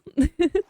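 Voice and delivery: bright little laugh; quick laugh at herself; short laugh. Real, not acted. Laugh after own remark.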